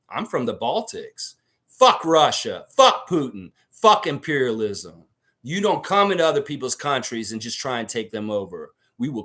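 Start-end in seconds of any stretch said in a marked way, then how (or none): 1.7-7.4 loud angry cursing, each phrase hit hard; furious outburst. Real, not acted. Angry outburst against Russia.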